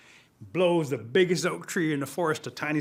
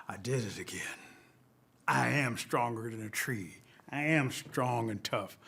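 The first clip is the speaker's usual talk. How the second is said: self-impressed turtle; 4 semitones lower, 4 dB quieter than usual. Acted. Turtle admiring his strength after breaking a stick, proud. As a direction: lower voice, proud and deliberate.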